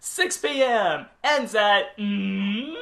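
loud, high announcement; announcer voice. Pretend. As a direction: read it like a PA announcer. Continuing the announcement bit.

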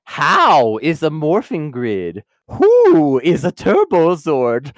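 very slow, drawn-out mystical questioning; Riddler. Acted. Riddler quizzing the Rangers on their own lore, mock-portentous. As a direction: stretch each question word like a hammy oracle. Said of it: "like, the Riddler".

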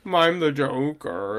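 slow, drawn-out mock villain line; mock Joker line. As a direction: ham up a slow Joker declaration. Mock Joker line after laughing.

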